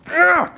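high, exasperated ugh; frustrated groan. Retold or imitated. Teacher showing Moses venting to God, a mock frustrated ugh. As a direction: let out a fed-up groan, acted.

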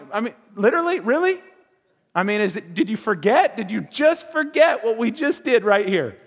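high, loud incredulous dad; can't believe she hit her again; disbelieving father, voice jumping up; exasperated disbelief. Retold or imitated. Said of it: "dad exasperated at fighting daughter".

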